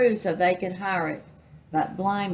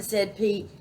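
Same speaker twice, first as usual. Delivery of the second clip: plain reading voice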